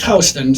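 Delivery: "Houston" with an OW vowel, HOW-stun; HOW-ston pronunciation. Shown as a demonstration. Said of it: "not pronounced".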